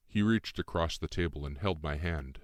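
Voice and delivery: usual speaking voice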